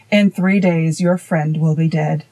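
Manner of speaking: plain reading voice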